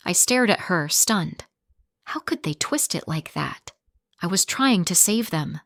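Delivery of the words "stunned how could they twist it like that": plain reading voice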